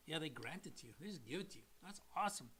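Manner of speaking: hushed little aside; quiet aside. Pretend. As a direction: drop to a soft murmur. Quiet aside voicing the listener.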